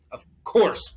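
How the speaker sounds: loud emphatic of course; emphatic answer. Acted. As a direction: promise it firmly. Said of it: "Horton answered".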